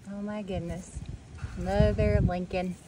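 ordinary talk, nothing marked

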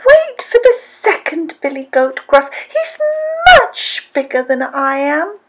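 very high-pitched little billy goat; little goat's cry. A narrator's reading. Little goat begs the troll for life, high pleading cry. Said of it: "cried the billy goat".

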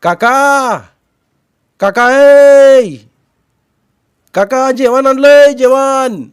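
high, drawn-out holler; calling out. A narrator's reading. Boy hollering that he has brought food, calling out. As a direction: shout it far into the night. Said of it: "त्यानं पुन्हा हाक मारली".

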